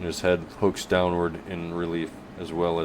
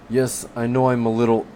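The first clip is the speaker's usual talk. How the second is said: put-on flustered young man; 6 semitones higher, 5 dB louder than usual. Rossner apologising, flustered.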